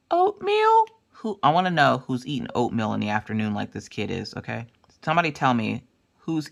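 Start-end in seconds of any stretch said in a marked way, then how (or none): 0.1-0.8 put-on very high child voice, questioning; tiny squeaky kid asking; little son. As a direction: imitate a small child, pitched way up.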